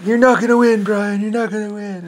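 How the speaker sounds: high, loud mocking taunt. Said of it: "Rodney's like".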